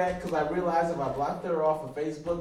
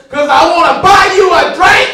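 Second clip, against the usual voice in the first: high, full-volume comic outburst; incredulous mock shout; 25 dB louder than usual. Drinker answering being called a bitch, incredulous shout. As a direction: bellow it in disbelief. Shouted comeback in acted quarrel.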